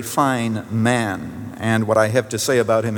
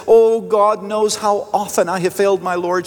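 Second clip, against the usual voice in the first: higher, mildly lamenting retold confession; lightly voiced remorseful woman; 7 semitones higher than usual. Woman confesses failure to her pastor, lightly imitated remorse. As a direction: pitch it up, a touch of lament.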